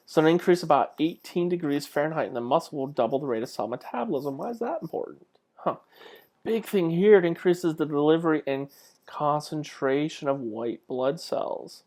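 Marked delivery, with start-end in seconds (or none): none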